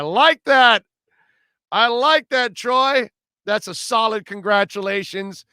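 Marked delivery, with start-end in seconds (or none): 0.0-3.1 high, loud, delighted approval; excited approval. Real, not acted. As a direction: cheer the caller on. Host cheering caller's line.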